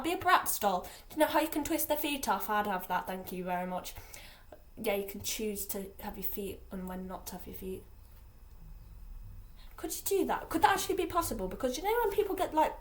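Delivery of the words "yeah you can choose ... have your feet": soft, unvoiced whisper; whispered aside. Real, not acted.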